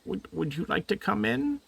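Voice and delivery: high, gentle little voice; high little creature voice; tiny creature voice. Acted. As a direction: chirp it like a foot-tall friend. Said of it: "he says".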